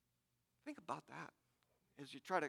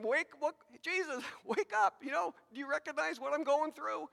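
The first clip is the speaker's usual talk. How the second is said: higher-pitched, agitated plea to a sleeper; urgent, raised-pitch appeal, half laughing; urgent pleading; 9 semitones higher than usual. Acted. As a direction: mock-desperate wake-up call. Acting out disciples waking Jesus.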